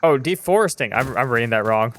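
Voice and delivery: normal talking voice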